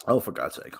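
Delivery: usual speaking voice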